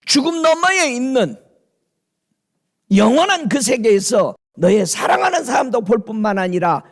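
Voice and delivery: high-pitched, urgent preaching; impassioned preaching. Acted. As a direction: drive the point home in a sustained high pitch. Preaches with raised intensity.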